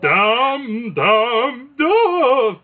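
Shakespearean actor. Retold or imitated. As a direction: declaim it like a stage tragedian. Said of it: "like a Shakespearean actor".

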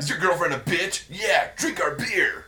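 announcer shout. Retold or imitated. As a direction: bellow it like a cheesy beer ad. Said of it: "quoting beer ad".

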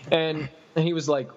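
ordinary talk

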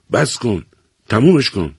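high, sharp order to stop; stern father's high command. A narrator's reading. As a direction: stop him right now. Father stops son choking himself.

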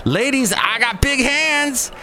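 high, slow swaggering brag; mock party boast. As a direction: ham up a guy bragging to the ladies. Imitating Stevie at a party.

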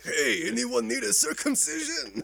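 slow, loud hammed-up Watto. Asked to do Watto, hammed-up creature voice. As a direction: croak it like the junk dealer. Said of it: "with Watto, say".

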